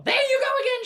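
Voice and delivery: shrill high loud outburst; crying out. A narrator's reading. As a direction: throw it up in exasperation. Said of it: "she cried".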